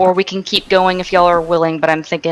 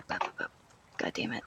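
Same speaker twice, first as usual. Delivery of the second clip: muttering under breath; 10 semitones lower, 23 dB quieter, much faster than usual. Real, not acted. GM fumbling, cursing under breath.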